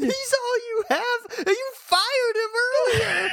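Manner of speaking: very high, laughing protest; comic indignant tirade; exasperated outrage. Pretend. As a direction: wail the argument in disbelief. Co-host joins the rant.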